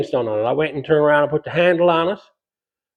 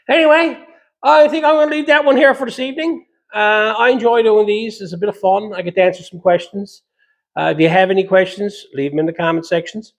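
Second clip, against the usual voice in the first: upbeat outro; 6 semitones higher than usual. Acted. Upbeat video outro to viewers.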